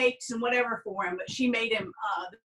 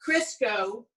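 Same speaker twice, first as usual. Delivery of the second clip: ordinary talk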